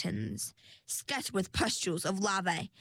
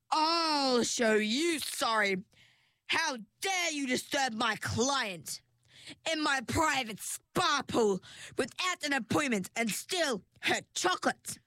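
furious; 5 semitones higher than usual. A narrator's reading. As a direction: lay into the intruder. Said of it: "a furious voice screeched".

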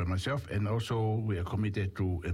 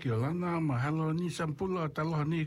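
bright, raised radio host greeting; 7 semitones higher than usual. Acted. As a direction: welcome listeners warmly on air.

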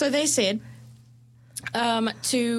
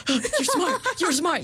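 gasp, then gush it high in mock amazement; over-earnest mock affirmation; 7 semitones higher, slower than usual. Showing listener how not to do affirmations, hammed-up gushing. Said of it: "you don't have to do it seriously".

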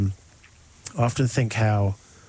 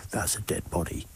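whisper; 5 semitones lower, 9 dB quieter than usual. Retold or imitated. Jackie quietly realising on boat.

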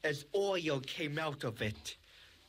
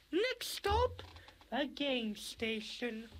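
high excited conductor voice; 8 semitones higher, slower than usual. Acted. Train conductor call.

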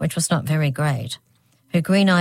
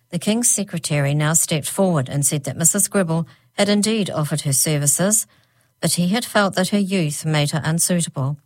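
usual speaking voice

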